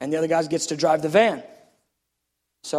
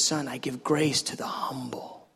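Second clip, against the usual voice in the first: slow, low near-whisper; gentle voice of God; 5 semitones lower, 8 dB quieter than usual. Retold or imitated. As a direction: hush it slowly like a father to his son. The Lord speaks gently to him.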